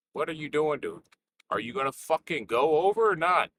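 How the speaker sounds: plain reading voice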